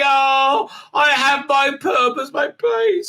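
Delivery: high, slow squealing delight; mock gushing. Tilly overjoyed at being offered a teaching job. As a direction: squeal it like a dream come true.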